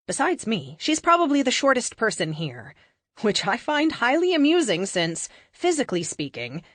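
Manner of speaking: ordinary delivery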